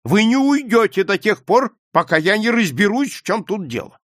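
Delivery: loud, high, indignant authority; shouting. A narrator's reading. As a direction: lay down the law as master of the house. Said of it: "крикнул Скалли". (громко, властно, возмущённо)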